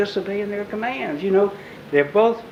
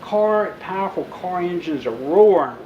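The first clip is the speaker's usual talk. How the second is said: usual speaking voice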